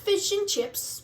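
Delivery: lightly put-on British accent on Fish and chips; mock British accent. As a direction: echo it in a hammy British accent. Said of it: "offended by our accent".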